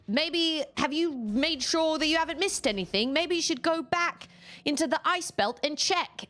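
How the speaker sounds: high, mock advice voice; quoting Miles. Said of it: "and was like".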